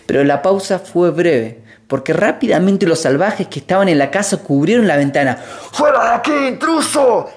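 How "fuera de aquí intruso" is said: high shouted mock demand; savages' demand. A narrator's reading. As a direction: chase the intruder away.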